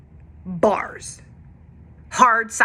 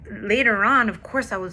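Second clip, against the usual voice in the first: ordinary delivery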